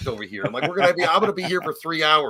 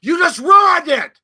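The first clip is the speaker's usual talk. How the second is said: high, loud, drawn-out accusation; shouted blame at a buddy; mock scolding shout; 13 semitones higher, 10 dB louder, much slower than usual. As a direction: yell it in playful outrage. Mock scolding over co-host cursing.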